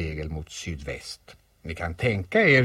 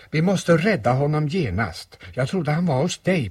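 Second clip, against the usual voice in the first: urgent alarm; 8 semitones higher, 6 dB louder than usual. Acted. Alarm at friend in danger.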